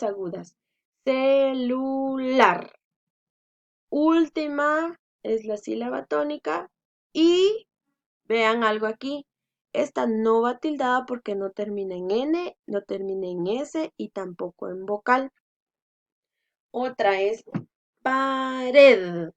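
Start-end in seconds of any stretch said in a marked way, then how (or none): 1.0-2.7 celular drawn out slowly, "ce-lu-LAR"; slow teacher's demo of celular, strong final syllable; sounded-out syllables. Shown as a demonstration. 18.0-19.3 pared drawn out, loud on "RED"; teacher's demo "pa-RED", louder last syllable; final-syllable stress. Shown as a demonstration.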